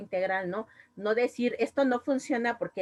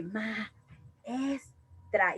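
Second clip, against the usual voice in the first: soft startled syllables; 5 dB quieter, much slower than usual. Shown as a demonstration. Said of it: "como espantados o como a espantar a alguien".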